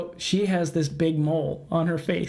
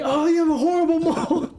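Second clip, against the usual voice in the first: pitched way up, bright and tactless; high mock blurt; 11 semitones higher, 4 dB louder than usual. Imagining telling the boss the truth, high silly voice. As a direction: squeak it like a cheery idiot. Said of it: "That wasn't this lady's voice".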